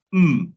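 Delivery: "Mm" as a loud humming sound; letter sound M. Shown as a demonstration. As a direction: hum "Mm" with closed lips, not the letter name. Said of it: "usted dice".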